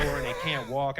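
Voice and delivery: ordinary delivery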